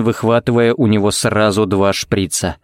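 ordinary delivery

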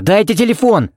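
high urgent call; urgent shout. A narrator's reading. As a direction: shout for the phone. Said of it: "крикнул он неожиданно громко".

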